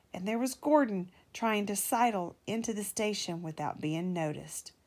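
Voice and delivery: ordinary delivery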